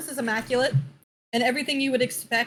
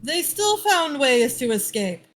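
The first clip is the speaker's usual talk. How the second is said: exasperated official; 5 semitones higher than usual. Acted. NPC exasperated about children.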